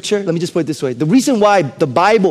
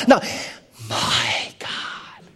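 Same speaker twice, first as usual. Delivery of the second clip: hushed anguished prayer; 4 semitones higher, much slower than usual. Acted. Pastor voicing anguished prayer.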